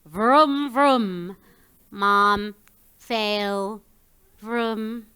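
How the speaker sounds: droning, spaced-out engine taunt; mocking toy car. Lost toy car taunting mom from the drain, mocking. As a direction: drone each word slowly like an engine. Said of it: "the McLaren's mocking call saying".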